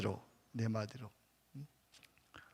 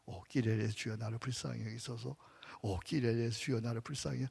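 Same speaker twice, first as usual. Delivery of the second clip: soft, low murmured Kyrie prayer; hushed, devout chant of the Kyrie; quiet prayer; 5 semitones lower, 11 dB quieter than usual. Shown as a demonstration. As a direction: pray it softly under the breath. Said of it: "해보세요".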